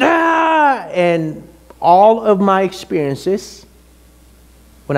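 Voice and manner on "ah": high frustrated yell; exasperated "Ah!" breaking out high; losing-it yell. Retold or imitated. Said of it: "I lost it".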